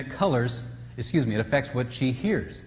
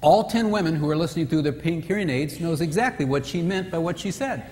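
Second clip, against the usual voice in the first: ordinary talk, nothing marked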